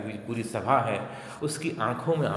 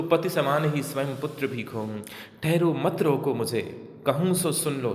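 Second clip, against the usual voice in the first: louder, insistent poetic declamation; emphatic verse recitation; 3 semitones higher, 4 dB louder than usual. Acted. Kaikeyi pleading with the assembly to hear her, emphatic recitation. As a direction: declaim it, demanding to be heard.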